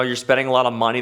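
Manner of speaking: ordinary talk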